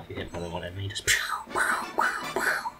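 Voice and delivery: unvoiced whispered pow; whispering. Real, not acted. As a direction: whisper the pows.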